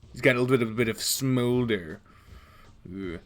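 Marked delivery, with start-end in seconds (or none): none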